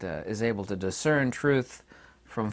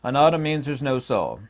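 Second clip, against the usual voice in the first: mocking the critics; 4 semitones higher, 6 dB louder than usual. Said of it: "They all say the same thing".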